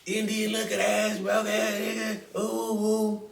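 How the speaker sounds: high, loud wailing rant; hammed-up hysterical screech at a partner; mock meltdown, ending in wailing; Tommy. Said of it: "Tommy was like".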